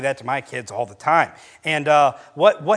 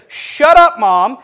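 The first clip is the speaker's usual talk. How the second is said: put-on loud, high jump on the boy's backtalk; sudden sharp yell imitating a boy's backtalk; retold bratty outburst; 8 semitones higher, 10 dB louder, much slower than usual. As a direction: yell it back at mom like a defiant kid. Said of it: "In the kindest".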